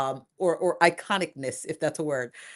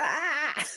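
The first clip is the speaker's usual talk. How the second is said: a playful, held-back cry of anguish; mock scream; 10 semitones higher than usual. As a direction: let out a small high-pitched mock scream. Imitates her screaming in prayer.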